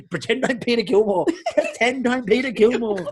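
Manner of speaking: giggling through the words; high, laughing imitation; laughing quote. Uncle voiced, laughing.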